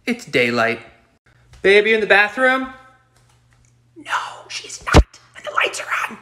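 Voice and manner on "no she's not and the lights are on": whispering. Real, not acted. Sneaky complaint while wife away.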